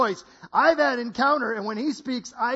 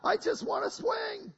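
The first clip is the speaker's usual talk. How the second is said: put-on pleading child; 10 semitones higher, 3 dB quieter than usual. Child begging to swing, high pleading voice.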